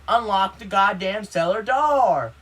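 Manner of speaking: loud, high, drawn-out demand; imitated furious shout, words stretched; angry neighbor yelling. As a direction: holler it through the house. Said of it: "I heard him yell".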